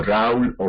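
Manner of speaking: Raúl and baúl as RÁUL, BÁUL; colloquial diphthong. Shown as a demonstration. Teacher imitating some people, Raúl and baúl in one syllable. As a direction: run au together in Raúl and baúl, stressing a. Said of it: "que dicen algunas personas".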